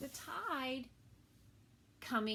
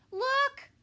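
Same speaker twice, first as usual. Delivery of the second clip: high, loud call to look; children pointing out the trail; excited shout; 18 dB louder than usual. Acted. Children calling out at the trail, high and loud.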